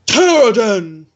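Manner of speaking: booming call, long drawn-out name; big booming voice. Acted. Man calling the questioner from off in the temple, booming. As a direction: call out the name in a big boom, somewhat held back. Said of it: "this big booming voice".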